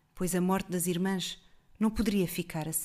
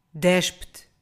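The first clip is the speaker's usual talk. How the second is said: loud looming monster command; voz ameaçadora; 7 dB louder than usual. A narrator's reading. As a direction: order it like a beast. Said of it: "uma voz ameaçadora". (ordem de monstro ameaçadora)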